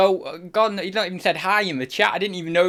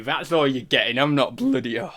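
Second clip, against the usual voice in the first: ordinary talk, nothing marked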